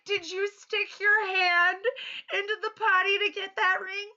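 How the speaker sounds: disbelief breaking into laughter; horrified laughing question. Retold or imitated. Horrified mom questioning child.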